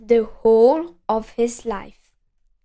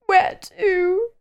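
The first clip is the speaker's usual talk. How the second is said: high, weepy little question; miserable whiny voice; 7 semitones higher than usual. A narrator's reading. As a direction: ask reluctantly. Mister Miserable asks.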